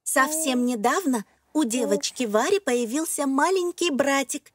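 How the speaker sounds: plain reading voice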